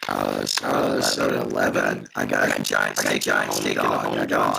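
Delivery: deep, distorted robotic connection glitch; garbled digital robot sound, like a bad call line. Real, not acted. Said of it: "You sound like a robot".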